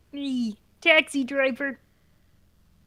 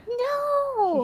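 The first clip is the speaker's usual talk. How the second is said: anguished cry; 10 semitones higher than usual. Real, not acted. Listener cries out in mock despair.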